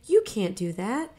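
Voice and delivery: put-on high, pressing older brother; pushy big brother. A narrator's reading. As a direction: push him hard, half scolding. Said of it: "I urged".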